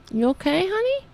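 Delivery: high, sing-song cooing to the cat. Real, not acted.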